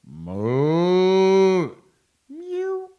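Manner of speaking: very high, loud, slow spooky wail; loud ghost boo. Acted. Ghost jumping out at Lydia Lou, loud spooky wail. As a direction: jump out and wail it. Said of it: "animals and ghost chant".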